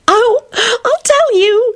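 very high, drawn-out glee; gleeful yelp. A narrator's reading. Solange eager to spill gossip, gleeful yelp. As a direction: squeal it with delight. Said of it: "she yelped gleefully".

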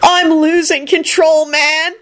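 high, drawn-out desperate cry; high overwrought dude wail; shriek. A narrator's reading. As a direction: shriek it slowly, overcome with love. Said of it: "Kenny shrieked".

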